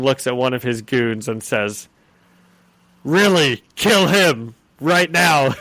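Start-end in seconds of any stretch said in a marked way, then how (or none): 3.0-5.5 slow, louder cold command; mock crime boss; menacing Kingpin. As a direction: order the kill without blinking. Kingpin ordering a kill.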